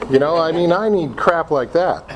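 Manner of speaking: ordinary delivery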